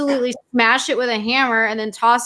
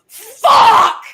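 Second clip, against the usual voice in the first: loud, high explosive yell; imitated scream; 11 semitones higher, 9 dB louder than usual. Partner heard screaming from down the street, furious yell. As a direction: scream it like a raging gamer. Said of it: "I could hear you all the way down the street screaming".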